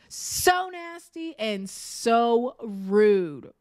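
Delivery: So nasty and so rude, stretched word by word, each word slow; slow, deliberate repeat of So nasty and so rude; drawn out, very slow. Shown as a demonstration.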